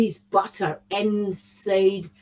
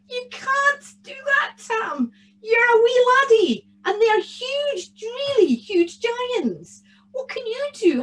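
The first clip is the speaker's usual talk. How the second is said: alarmed mother's protest; 12 semitones higher, 4 dB louder than usual. A narrator's reading. Mother tries to stop her wee laddie, dismayed. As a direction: protest high and stretch out the warnings.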